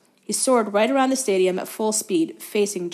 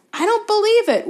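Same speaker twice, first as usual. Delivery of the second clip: much higher, loud outrage; outraged hiss; 14 semitones higher, 6 dB louder than usual. A narrator's reading. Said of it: "Wood hissed in outrage".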